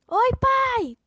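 high, loud call to dad. Acted. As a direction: call across the street. Chamando o pai de longe. (chamando alto)

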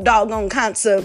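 normal talking voice

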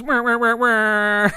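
sad trombone wah wah wah; imitating a sad trombone horn. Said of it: "the little horn that goes".

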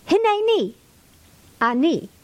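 Hineni cried out high, then Ani; excited Hebrew. Shown as a demonstration. Teacher showing the class how exciting Hebrew sounds. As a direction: exclaim Hineni like a thrilling behold. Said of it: "it sounds exciting in the Hebrew".

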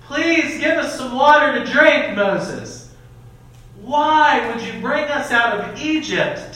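put-on loud, pleading, whining like a child; whiny kid voice. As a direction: complain like a spoiled kid begging for water. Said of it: "like, a whiny kid".

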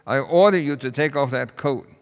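teacher losing patience, commanding; frustrated order. A narrator's reading. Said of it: "getting a little frustrated and finally said".

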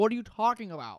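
mild mock indignant yell; retold yelling. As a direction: push back as if wrongly accused. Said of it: "she's yelling at him saying".

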